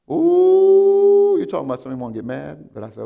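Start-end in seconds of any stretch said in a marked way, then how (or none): 0.1-1.4 high, loud exclamation of rising anger; high loud ooh. Retold or imitated. Pastor recalling his flash of anger, high loud ooh. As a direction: let out a long piercing ooh.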